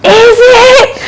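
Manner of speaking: high, drawn-out sarcastic yell; mock-frantic high-pitched outburst; retold yell. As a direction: yell it back in panicked disbelief, pitch shooting way up. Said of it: "I just yelled at him".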